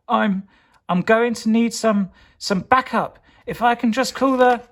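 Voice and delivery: high, loud heroic voice; heroic hurried call. Acted. Soup Potato trapped on the belt, high urgent heroic voice. Hero trapped, calling backup.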